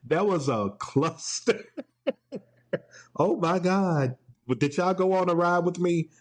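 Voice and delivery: usual speaking voice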